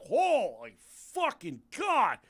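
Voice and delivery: high, drawn-out gasping awe; mock astonishment. Joel stunned by what he saw, hammed-up astonishment. Acting Joel's shock in bathroom.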